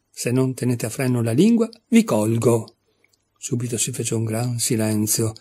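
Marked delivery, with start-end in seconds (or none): none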